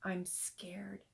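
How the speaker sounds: breathless frightened whisper; a stuck boy whispering his fear. A narrator's reading. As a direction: barely whisper it, scared stiff. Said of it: "He hesitated to move and whispered".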